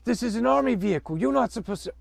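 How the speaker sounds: high, fast, loud scolding guard; bossy soldier voice. Acted. Voicing a soldier in the game.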